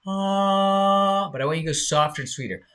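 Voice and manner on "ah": louder head voice. Shown as a demonstration. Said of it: "people go louder".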